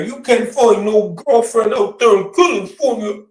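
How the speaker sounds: lightly mocking a big talker; a hint of a boastful friend; imitating big talk. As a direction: puff it up like a show-off. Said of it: "the way he talks big talk".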